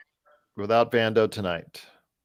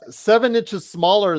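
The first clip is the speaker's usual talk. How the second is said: yelling from the sidelines; 8 semitones higher, 6 dB louder than usual. Real, not acted. Yelling at player on TV.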